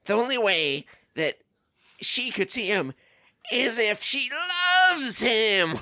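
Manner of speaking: high, building villain reveal; dramatic villain voice. Retold or imitated. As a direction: ham up the villain's realization.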